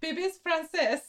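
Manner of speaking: put-on high, poor French accent; failed French accent. Reading the game list in a failed French accent. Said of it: "Gotta say a little more French".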